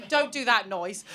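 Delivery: ordinary talk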